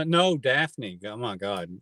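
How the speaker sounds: ordinary talk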